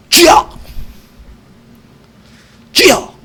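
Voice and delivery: a sudden startled cry to the Lord; crying out to God. Shown as a demonstration. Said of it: "이래야 된다".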